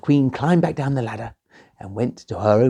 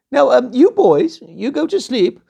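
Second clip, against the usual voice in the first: high, loud sweet-talking queen; 12 semitones higher, 7 dB louder than usual. Acted. Queen asking the princess about her bed, high and coaxing. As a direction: coax her like a scheming queen.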